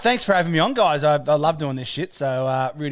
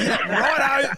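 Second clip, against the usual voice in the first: his own catchphrase; 8 semitones higher, slower than usual. Retold or imitated. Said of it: "I just wanna say".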